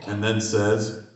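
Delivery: usual speaking voice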